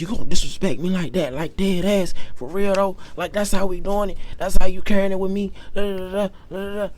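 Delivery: high-pitched acted indignant rant; spazzing out. Boyfriend spazzing on the phone over disrespect, acted rant. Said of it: "I'm spazzing".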